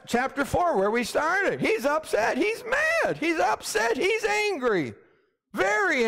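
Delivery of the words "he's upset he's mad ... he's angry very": high, shouted emphasis on Jonah's anger; preacher crying out that Jonah is mad; rising preaching shout. Acted. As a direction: build it up, hammering each line.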